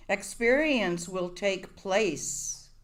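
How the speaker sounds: ordinary talk, nothing marked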